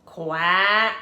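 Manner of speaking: loud quacking duck voice; put-upon duck quacking. A narrator's reading. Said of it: "The duck answered".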